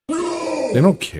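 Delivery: high, crying whine, hammed-up; mock wail. Streamer mock-sobs at chat about his team. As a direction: wail it like fake sobbing.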